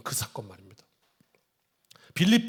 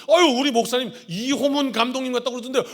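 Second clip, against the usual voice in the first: excited flattery; 8 semitones higher, 8 dB louder than usual. Retold or imitated. Another member gushing.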